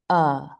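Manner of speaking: "uh" as a relaxed, neutral vowel; schwa sound. Shown as a demonstration. Teacher showing the second sound of A, schwa sound. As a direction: say "uh" from the throat with a loose jaw. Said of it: "the schwa sound is also for the letter".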